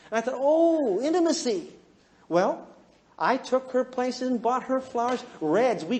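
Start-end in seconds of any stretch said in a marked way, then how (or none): 0.4-1.7 mock realisation. Mock realisation in his thought.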